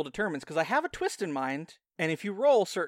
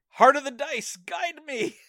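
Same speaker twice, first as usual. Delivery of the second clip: mock prayer; 10 semitones higher, 7 dB louder than usual.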